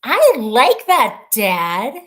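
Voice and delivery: delighted approval; excited. Real, not acted. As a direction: enthusiastic exclamation. Excited about the name.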